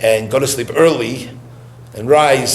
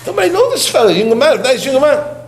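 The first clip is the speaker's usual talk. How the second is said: much higher, wheedling imitation; mock pleading; 11 semitones higher than usual. Pleading with the Rebbe about the young man, high mock coaxing. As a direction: coax in a high put-on voice.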